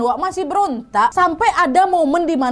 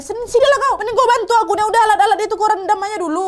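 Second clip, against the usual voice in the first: higher-pitched, impatient senior calling a junior over; bossy ordering; 6 semitones higher, faster than usual. Retold or imitated. Senior midwife telling the new colleague to help, bossy. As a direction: order them to come and help.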